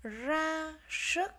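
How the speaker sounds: "Ra sức" high, rolled R and long hushed S; careful R and S. Shown as a demonstration. Teacher modelling "Ra sức" for pupils, strong R and S. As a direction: draw out the R of "Ra" and S of "sức". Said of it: "chúng ta phát âm là S".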